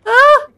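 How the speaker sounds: sharp high loud shriek; fright scream. Real, not acted. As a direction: scream at the scare. Another jump scare.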